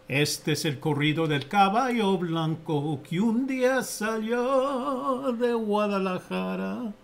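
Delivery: hammed-up corrido intro, slow ending; mariachi announcer. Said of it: "That's mariachi there".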